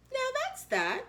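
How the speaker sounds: high satisfied chirp; pleased, high "now that's that". Acted. Horton satisfied.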